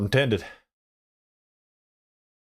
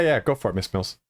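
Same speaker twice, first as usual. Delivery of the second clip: ordinary talk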